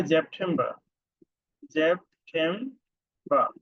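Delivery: জেপ্টেম্বর with a z sound at the start; জেপ্টেম্বর said slowly the German way; German September. Shown as a demonstration. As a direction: stretch জেপ্টেম্বর slowly, zep-tem-ba. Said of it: "spelling same, but প্রনাউন্সিয়েশনটা একটু ভিন্ন".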